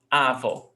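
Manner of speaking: Avó with a strong A and weak vó; stress on first syllable. Shown as a demonstration. Teacher repeating the wrong version, Avó stressed at the start. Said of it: "It's not like that".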